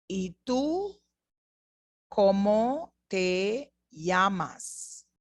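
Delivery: very slow and clear; slowed-down question; slow model question. Shown as a demonstration. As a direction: draw it out for learners.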